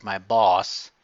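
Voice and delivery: usual speaking voice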